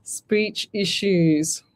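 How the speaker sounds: very slow, careful enunciation; drawn-out, over-careful delivery; slow and deliberate. Real, not acted. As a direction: spell it out word by word. Slowly sounding out troublesome words.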